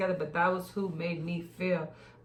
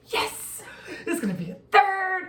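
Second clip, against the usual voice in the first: much higher and louder, laughing sing-song; gleeful dad; 12 semitones higher, 7 dB louder than usual. Retold or imitated. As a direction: gloat in a singsong, laughing. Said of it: "He's like".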